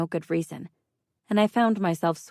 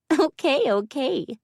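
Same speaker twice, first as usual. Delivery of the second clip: giggling; 10 semitones higher, 3 dB louder, slower than usual. A narrator's reading. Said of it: "she exploded into giggles".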